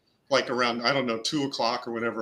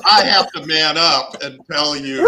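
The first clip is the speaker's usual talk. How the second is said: loud, laughing confession; 2 semitones higher, 10 dB louder than usual. Real, not acted. Prankster recalling owning up to the victim, laughing loudly. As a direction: push the words out loudly through real laughter.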